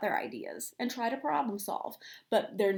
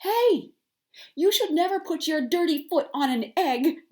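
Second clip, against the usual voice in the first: loud, high scolding shout; 7 semitones higher, 7 dB louder than usual. A narrator's reading. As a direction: tell him off sharply. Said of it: "Duck scolded".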